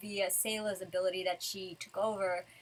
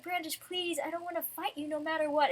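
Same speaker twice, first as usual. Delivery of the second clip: high-pitched imitated plea; mock pleading; 7 semitones higher, faster than usual. Lucy begs Brandish not to fight, pleading. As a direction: plead high and earnest, lightly acted.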